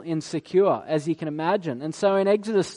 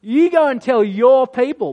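louder, higher huffy "You go and tell your people"; mock-huffy brush-off, louder and higher; petulant God; 5 semitones higher, 5 dB louder than usual. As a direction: play God as a sulking husband. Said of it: "He's like".

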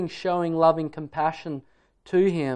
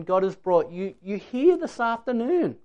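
ordinary delivery

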